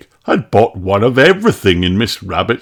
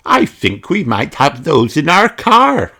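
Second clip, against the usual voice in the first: higher, eager piglet; higher, eager little girl voice; excited cry; 7 semitones higher than usual. Acted. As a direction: Peppa with a bright idea. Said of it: "cried Peppa".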